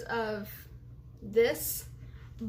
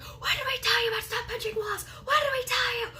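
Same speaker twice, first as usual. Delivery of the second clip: family yelling; 12 semitones higher than usual. Retold or imitated. Family yelling at her for punching walls, exasperated shout. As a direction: shout it like a fed-up parent. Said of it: "They're like".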